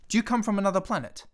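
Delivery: put-on a pilot pressing the boy for answers; abrupt demand. A narrator's reading. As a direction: blurt it out urgently. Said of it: "I demanded abruptly".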